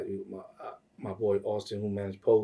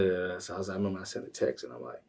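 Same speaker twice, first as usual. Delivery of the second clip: ordinary talk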